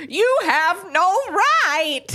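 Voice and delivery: hammed-up, drawn-out high yell; angry old-man yell. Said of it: "old man angry, yells".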